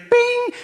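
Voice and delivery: very high ringing ding of a fairy's magic; magic bing. Acted. Fairy granting the wish, high magic bing. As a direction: sing it out like a tiny magic chime. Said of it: "And the fairy went".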